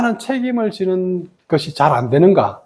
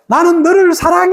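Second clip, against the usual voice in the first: acted high-pitched love declaration; lovey declaration; 7 semitones higher than usual. Immature lover to partner, high-pitched mock declaration. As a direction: declare love in a raised, hammed-up voice.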